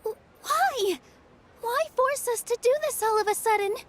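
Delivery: high, stammered disbelief; stunned at the sudden order; shocked dismay. Acted.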